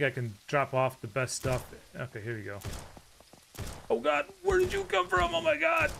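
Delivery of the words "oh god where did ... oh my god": startled scream. Real, not acted. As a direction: yell in fright, scolding what scared you. Jump scare in game.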